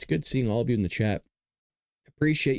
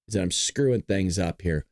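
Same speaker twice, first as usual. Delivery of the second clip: ordinary talk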